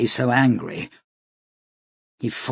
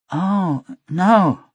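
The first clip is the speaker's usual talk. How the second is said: startled; 8 semitones higher, 3 dB louder than usual. A narrator's reading. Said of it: "her expression growing startled".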